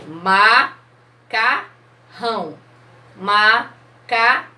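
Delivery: macarrão as MA, CA, RRÃO with gaps; syllable by syllable. Shown as a demonstration. Teacher counting mouth openings for children, slow syllable by syllable. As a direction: say macarrão slowly in three separate beats. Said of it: "quantas vezes eu abri a minha boca".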